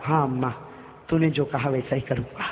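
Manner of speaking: low, soft, sorrowful reply; tearful assent. Acted. Prophet answering the old mother with tears, subdued and sad. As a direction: answer quietly as if through tears. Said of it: "روتے روتے فرمایا".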